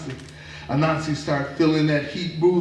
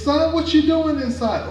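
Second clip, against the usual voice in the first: high mama voice; 11 semitones higher, 4 dB louder than usual. Acted. Mama questions son.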